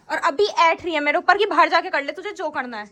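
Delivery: ordinary delivery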